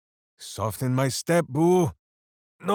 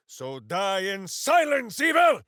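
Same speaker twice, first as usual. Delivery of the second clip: battle shout; 5 semitones higher than usual. Acted. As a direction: roar it at the enemy. Battle cry at foe.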